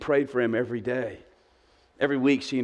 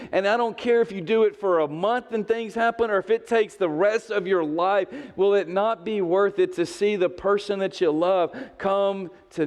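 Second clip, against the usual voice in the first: higher, pressing challenge; fervent preaching; 6 semitones higher than usual. Acted. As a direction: urge the church on. Preaching at full force.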